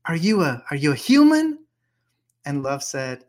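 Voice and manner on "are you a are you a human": awed questioning. Acted. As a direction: marvel at love, rising to a near cry. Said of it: "Rumi starts talking to love".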